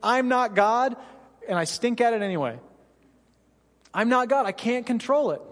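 earnest confession. Retold or imitated. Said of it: "you're basically saying".

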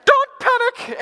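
very high, loud squawked warning; imitating a guide yelling over the bus mic; mock high-pitched alarm; tour guide on mic. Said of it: "get on the mic and she'd go".

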